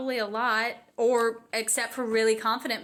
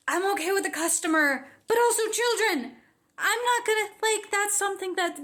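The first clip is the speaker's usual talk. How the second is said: pitched up, bright service voice; high customer voice; 8 semitones higher than usual. Shown as a demonstration. Talking to customers and children, the high voice. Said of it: "a really high voice if I'm speaking to a customer".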